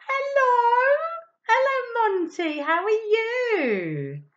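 high, loud, delighted hello; high, loud, slow welcome to the puppet; excited greeting. Acted.

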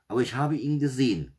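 put-on child firmly standing by her claim; insistent. A narrator's reading. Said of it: "beharrte Janina".

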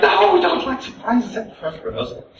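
high-pitched group objection; playful acted-out refusal; mock protest.

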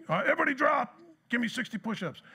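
barked high drill order; a hammed-up drill instructor yelling; mock drill sergeant. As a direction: play the drill sergeant barking at the platoon. Drill sergeant retold shouting orders.

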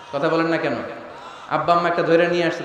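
ordinary delivery

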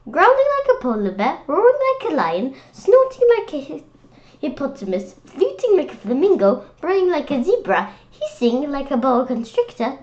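high, loud and fast, getting carried away; excited build-up. Acted. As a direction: rattle off the animals with rising excitement. Building list of animal sounds.

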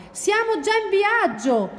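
high-pitched gleeful announcement; excited correction. Acted. Porcospino tells Ranocchio they are already travelling, excited. Friend answers the excited frog.

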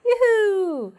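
high, loud drawn-out whoop; cheering. Shown as a demonstration. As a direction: call it out in celebration. Demonstrating a cheer. (신나서 외치듯이)

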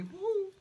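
high-pitched party whoop; high playful "woo". Pretend. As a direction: toss in a little high woo. Cheers for the party.

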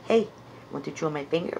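ordinary talk, nothing marked

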